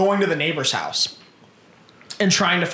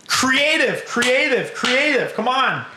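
high-pitched exasperated urging; exasperated plea; 9 semitones higher, much slower than usual. Real, not acted. Pleading with Hollywood to be creative, exasperated. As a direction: push each word up high, pleading.

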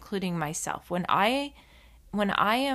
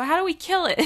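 much higher, a touch louder, mock-innocent ask; deadpan mock question; 10 semitones higher, 5 dB louder, faster than usual. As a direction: toss it off like a mischievous kid. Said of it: "And I go".

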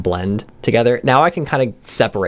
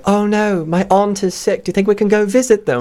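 higher-pitched mock British accent, "aunt" as "ahnt"; 8 semitones higher than usual. Shown as a demonstration. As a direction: put on a theatrical British accent. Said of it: "have an accent that's not your own".

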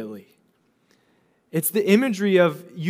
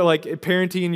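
ordinary talk

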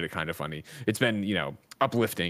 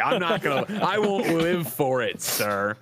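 hammed-up outrage, laughing through it; fake-tell off a rival with a grin; playful mock fury at Gino; mock furious callout; 4 semitones higher, slower than usual.

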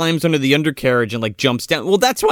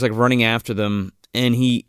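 ordinary delivery

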